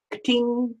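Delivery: cash register ka-ting. Acted. Cash register sound effect.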